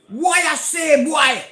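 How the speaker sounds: high, loud shouted question; retold shout. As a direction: call it out sharply, imitating the neighbour. Neighbour shouts at him in retelling.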